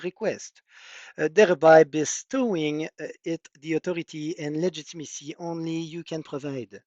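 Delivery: put-on pompous, high French accent. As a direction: read it like a French courtier, French accent. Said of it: "push my French accent when I, I speak this way".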